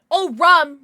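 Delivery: sudden burst of loud delight; light up at remembering; suddenly loud, pleased discovery; excited. Real, not acted. Remembering the other drink option.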